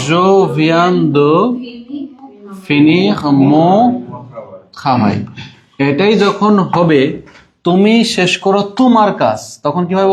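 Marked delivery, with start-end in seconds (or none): 0.1-5.2 teacher pace, word by word; slow model sentence. Shown as a demonstration. Teacher modelling the sentence for learners to repeat, very slow. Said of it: "এইভাবে বারে বারে practice করতে হবে".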